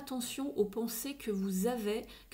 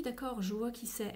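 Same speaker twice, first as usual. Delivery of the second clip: ordinary delivery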